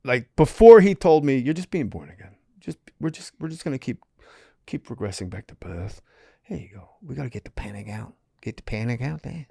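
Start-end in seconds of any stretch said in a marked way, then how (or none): 1.4-9.5 softer Australian healer, hushed; Australian accent. Retold or imitated. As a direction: coax the panic out, Aussie style. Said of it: "fucking Australian accent".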